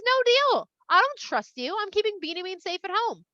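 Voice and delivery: high, forceful storyteller's refusal; firm refusal. Retold or imitated. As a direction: act out a father putting his foot down. Father firmly refuses his son.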